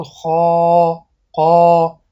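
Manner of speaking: خا and قا said slowly with a long aa; drawn-out letter sounds. Shown as a demonstration. Teacher demonstrating long vowels to students. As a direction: stretch خا, قا slowly for two beats.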